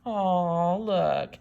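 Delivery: ordinary delivery, nothing marked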